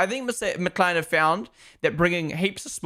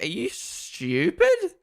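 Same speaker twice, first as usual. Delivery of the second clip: slow, drawn-out disbelief on STUPID; incredulous scorn; 13 semitones higher, much slower than usual. Real, not acted. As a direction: stretch stupid out, higher, in disbelief. Ranting at people mispronouncing a name.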